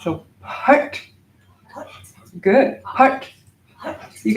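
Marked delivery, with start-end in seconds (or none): 0.4-1.0 "part" launched on a puff of air; aspirated pronunciation. Shown as a demonstration. Teacher applying the aspirated P H, puffed, short "part". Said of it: "it's an aspirated P H". 2.8-3.3 higher, louder "Part" on a puff of air; aspirated pronunciation. Shown as a demonstration. Teacher modelling the seed syllable, explosive "Part". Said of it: "it's an aspirated P H".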